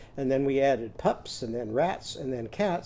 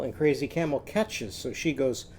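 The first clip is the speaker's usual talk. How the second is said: ordinary delivery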